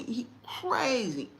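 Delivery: slowly drawn out; slow emphasis. Real, not acted. Telling moms slowly about the son. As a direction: stretch it to drive it home. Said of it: "repeated slow emphasis".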